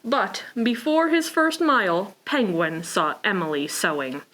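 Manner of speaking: ordinary delivery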